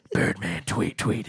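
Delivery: low soft superhero voice; low Birdman voice. Pretend. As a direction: growl it like a bird hero. Playing Birdman.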